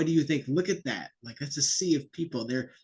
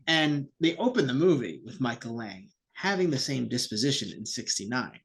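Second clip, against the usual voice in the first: usual speaking voice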